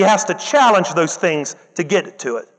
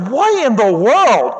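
slow, louder baffled exclamation; shocked disbelief; 4 semitones higher, 4 dB louder, slower than usual. Retold or imitated. Teacher baffled by student rolling on floor, slow disbelief. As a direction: stretch it out in disbelief. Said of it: "I was like".

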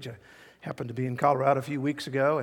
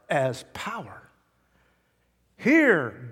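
slow proclamation with long pauses and loud key words; dramatic preaching; 3 semitones higher, 5 dB louder, slower than usual. Real, not acted. Preacher declaring God is power, dramatic preaching. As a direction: let each phrase land hard.

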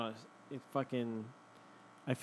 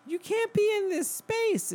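much higher put-on voice; squeaky lady. The lady ordering the driver, squeaky. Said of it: "her voice was very like this".

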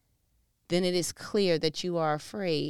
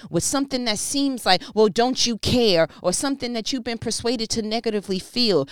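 louder, higher preaching build; full-force preaching; 4 semitones higher, 5 dB louder than usual. Acted. Pastor urging the congregation to trust, preaching at full force. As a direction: preach it, climbing and pressing.

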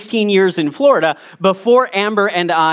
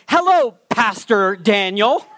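slow, with PASTOR held up in mock quotation marks; sarcastic scare quotes; slower than usual. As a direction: say Pastor as if the title were dubious. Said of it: "putting pastor in quotation marks".

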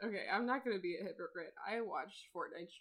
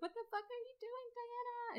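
mock bewildered soldiers; 6 dB quieter than usual. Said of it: "People are like".

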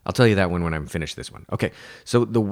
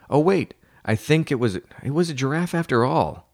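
mild higher-pitched realization; sudden second thought; 7 semitones higher than usual. Acted. Joke teller second-guessing the bear or giraffe, sudden doubt.

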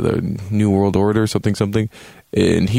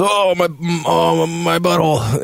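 mock pained moan; 9 semitones higher than usual. Mocks character's cry of pain.

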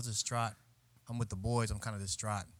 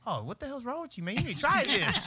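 mock pushy offer; 12 semitones higher than usual. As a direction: push the dish on him playfully. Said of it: "I was like".

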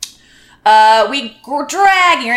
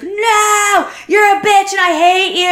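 much higher, fast mock shouted insult; hammed-up drunk shout, pitched way up; drunk screaming roommate; 9 semitones higher than usual. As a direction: shriek it like a drunk furious roommate. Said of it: "She's like".

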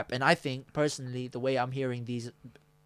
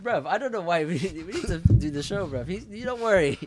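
loud, laughing hype; hyped up; 6 semitones higher, 8 dB louder than usual. Real, not acted. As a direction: gas him up, loud and laughing. Hyping up a friend, laughing.

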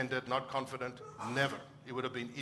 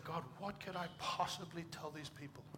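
higher, softer self-doubting appeal; retold helpless plea; 4 semitones higher, 7 dB quieter than usual. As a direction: recall asking God, at a loss. Retells his insecure prayer to God.

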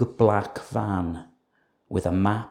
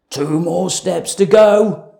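thief voice; 7 semitones higher, 8 dB louder than usual. A narrator's reading. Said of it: "said Hugh".

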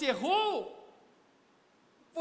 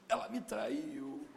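desperate lament; much slower than usual. Retold or imitated.

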